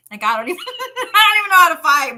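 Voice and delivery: high, loud and laughing through it. Real, not acted. Admitting to the host she can't fight, high laughing. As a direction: giggle your way through the words.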